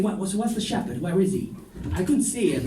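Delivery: ordinary talk, nothing marked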